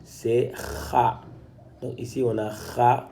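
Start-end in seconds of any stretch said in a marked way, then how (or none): 0.5-1.2 "kha" with a rasped back-of-throat kh; rough throat friction opening "kha"; dotted kha sound. Shown as a demonstration. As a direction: scrape the kh at the start of "kha". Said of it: "Quand on a le point, c'est kha".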